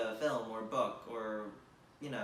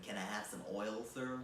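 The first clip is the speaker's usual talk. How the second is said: put-on softer, lower plain American accent; 5 semitones lower, 6 dB quieter than usual. Same plea redone in an American accent. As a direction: ask flatly in an American accent. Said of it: "in an American accent".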